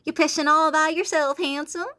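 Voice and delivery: yeehaw it like a flirty Texan; a hammed-up Southern twang; Southern accent. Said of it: "I will yeehaw the fuck out of the line".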